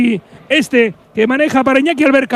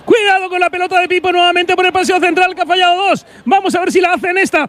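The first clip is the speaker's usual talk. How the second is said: live play-by-play; 4 semitones higher than usual. Real, not acted.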